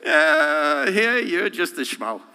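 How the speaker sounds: high teasing brush-off; hammed-up scoffing voice; mock dismissive. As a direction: wave him off as a nobody. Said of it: "the other places are like".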